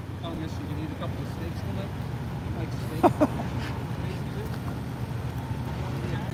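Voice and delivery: a long, soft, breathy laugh; long real laugh. Real, not acted. Streamer laughing at chat trolls, long soft laugh. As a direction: laugh it off, quiet and helpless.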